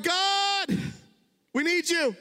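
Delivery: desperate cry to God. Acted. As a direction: cry out like a people at the end of their rope. People crying out to God.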